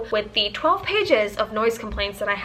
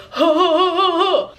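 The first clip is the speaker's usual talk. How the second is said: loud, higher hammed-up laugh of the neighbor; mock booming laugh; 7 semitones higher, 7 dB louder than usual. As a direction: belt out the neighbor's big laugh. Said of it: "Every time this man laughs".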